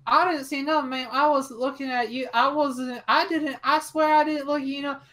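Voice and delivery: put-on high-pitched, pleading child voice; scared little boy. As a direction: protest innocence like a frightened kid. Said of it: "And he's like".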